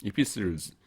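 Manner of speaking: "Epistles" said without any T sound; silent T. Shown as a demonstration. Lecturer models the plural for students, T silent. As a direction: say "Epistles", S running straight into L. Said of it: "요거 T자 묵음돼요".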